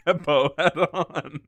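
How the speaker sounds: usual speaking voice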